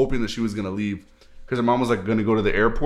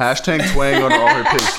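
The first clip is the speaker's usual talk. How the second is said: laughing echo; 4 semitones higher, 6 dB louder than usual. Real, not acted. Mocking echo of friend's line.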